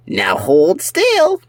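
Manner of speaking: slow, high purring menace; villain cat voice. Acted. Cyber cat to the captured pets, slow and high. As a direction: drawl it out like a sly villain cat.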